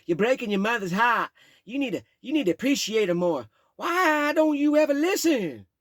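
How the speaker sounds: put-on high, loud drunken lecturing; beer-fueled rant. Stepfather ranting at the boy: loud high drunk rant. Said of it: "fueled rant".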